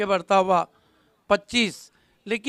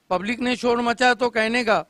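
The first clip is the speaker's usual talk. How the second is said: ordinary delivery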